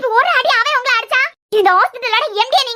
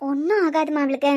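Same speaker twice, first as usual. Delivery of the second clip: gentle comfort; 6 semitones lower than usual. Acted. Comforting crying mother.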